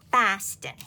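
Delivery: Boston said as Bahstah, dropped r, loud; a try at a Boston accent on Boston. Shown as a demonstration. Crafter tries the actor's Boston accent, mock Boston. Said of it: "I can't do a Boston accent".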